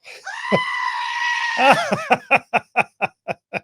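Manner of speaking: high, explosive real laugh; burst of laughter. Real, not acted. Host reacting to the congresswoman's quote, loud real laugh. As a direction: crack up at the quote. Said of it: "Cracks me up".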